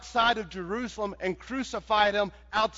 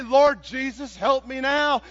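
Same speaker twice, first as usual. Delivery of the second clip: mock late plea; 4 semitones higher, 6 dB louder than usual. Shown as a demonstration. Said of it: "don't say".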